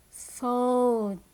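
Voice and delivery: "Phone" drawn out; slow repeat of "Phone" with a stretched vowel; long O in phone. Shown as a demonstration. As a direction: linger on the O in "Phone". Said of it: "words that have the long sound".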